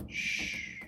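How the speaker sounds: soft unvoiced secret; whisper. A narrator's reading. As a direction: lean in and whisper. Narrator hushes a secret.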